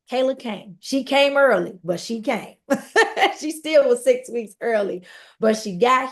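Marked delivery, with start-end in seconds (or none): 2.6-3.3 a quick high burst of laughter; short real laugh; bright laugh. Real, not acted. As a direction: laugh it off, amused. Laughing at her own story.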